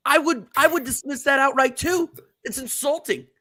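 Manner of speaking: high-pitched, indignant outburst, pitch jumping way up; outraged rant. Real, not acted. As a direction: rant as if personally insulted. Fan fuming over lowball bid.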